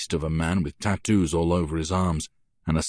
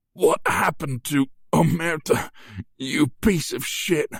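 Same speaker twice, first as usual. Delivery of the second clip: gasped out; 9 semitones higher than usual. A narrator's reading. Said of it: "I gasp out".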